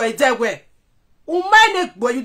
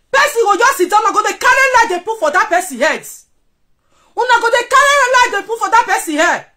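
raised, heated scolding; angry rant; 6 semitones higher than usual. Real, not acted. Ranting at a rival on live video, heated and raised. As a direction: tell him off hotly.

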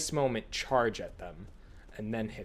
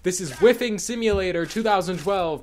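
mock announcer; 6 semitones higher, 5 dB louder than usual.

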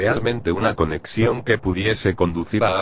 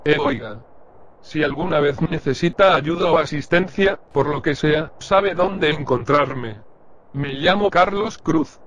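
higher, eager cashier's voice; friendly shopkeeper; 8 semitones higher than usual. Acted. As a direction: serve the customer brightly. (voz aguda de cajero amable)

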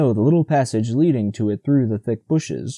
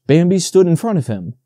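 ordinary delivery

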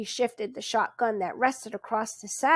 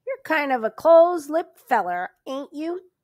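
sour; 5 semitones higher, 8 dB louder than usual. Acted. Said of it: "His sour mood returned".

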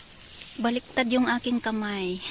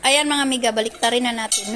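ordinary delivery

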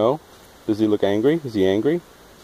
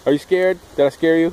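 high sing-song; 8 semitones higher, 4 dB louder than usual. Real, not acted. Talking to toddler.

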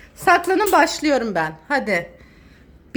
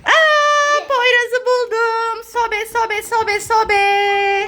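excited; 6 semitones higher, much slower than usual. Real, not acted. Finding hidden child, excited.

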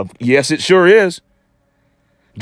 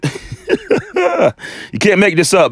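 laughing; 4 semitones higher, much slower than usual. Real, not acted. Laughing at the commenter's exit.

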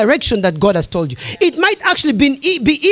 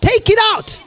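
emphatic repeat; 7 semitones higher than usual. Real, not acted. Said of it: "You've got to take it out".

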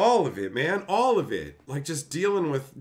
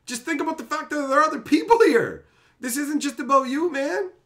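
higher and louder fed-up scolding; exasperated lecture; 11 semitones higher, 5 dB louder than usual. Retold or imitated. Organizer lectures selfish players at the event, exasperated and loud. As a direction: lay into them like a fed-up organizer.

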